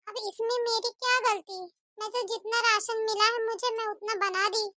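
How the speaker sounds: ordinary talk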